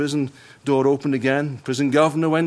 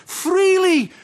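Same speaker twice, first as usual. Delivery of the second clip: much higher, louder ringing call; proclaimed; 4 dB louder than usual. Acted. Christ charging his disciples, ringing proclaimed call. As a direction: cry it out to the hall.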